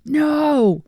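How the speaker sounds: high, drawn-out mock scream; retold scream. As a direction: holler a long high no at the dog. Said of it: "and I just screamed".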